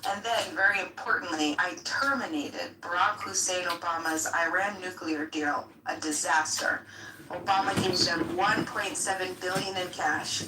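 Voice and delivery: lightly voiced Trump; Trump impression. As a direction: read the quote like Trump. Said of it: "This was his quote about Obama".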